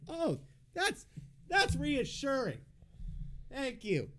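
high, slow, mock-grateful sarcasm; sarcastic thanks pitched far above his own voice. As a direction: drip fake gratitude way up high. Sarcastic reply to nurse's prison comparison.

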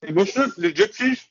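talking while eating; words round a bite of food; mouth full. Real, not acted. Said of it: "Sorry, I'm doing a Chris here".

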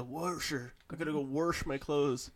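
"wash" said as worsh; "wash" with an intrusive R, worsh; dad's added R. Retold or imitated. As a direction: put a hard R into "warsher" and "wash". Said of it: "my dad says".

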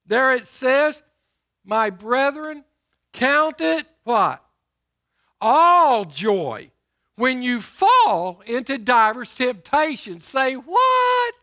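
loud, ringing pulpit delivery; booming sermon voice; preaching at full force. Acted. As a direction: preach it out to the congregation. Preacher reads scripture with call-outs.

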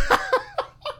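squealing laugh breaks out; real high laugh; high burst of laughter. Real, not acted. Laughing at own nausea.

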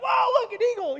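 very high, loud exclamation; distracted excitement. Newly sighted man spotting a bird, wildly excited. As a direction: yelp it like a hammed-up distracted enthusiast. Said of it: "He's all A D D in this moment".

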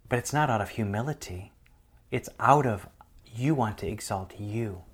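God whispering a rebuke; whispered words of God. Retold or imitated. The Lord's whisper retold.

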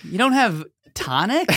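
high-pitched rising disbelief; mock incredulous. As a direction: question it as a baffled customer. Acting out a baffled bar customer.

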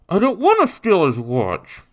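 put-on high, loud child's protest; reluctant boy. A narrator's reading. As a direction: refuse in an upset boy's high voice. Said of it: "Jacques continued to mumble".

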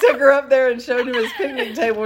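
giggling through the words; laughing. Real, not acted. Laughing as she retells the picnic story. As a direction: laugh while you tell it.

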